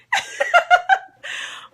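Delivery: high, breathy burst of laughter; embarrassed laugh. Real, not acted. Vlogger laughing at herself about crying pretty. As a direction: laugh off the tears.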